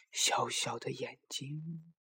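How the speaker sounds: soft, very slow echo in the mind; drawn-out quiet recollection; remembered words. A narrator's reading. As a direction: recall it hushed, drawing out each word. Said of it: "小兔子想起妈妈说的话".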